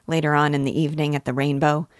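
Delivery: ordinary delivery, nothing marked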